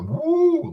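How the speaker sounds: sudden high whoop of delight; excited woo. Real, not acted. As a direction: let out a quick high woo. Excited reaction to singer.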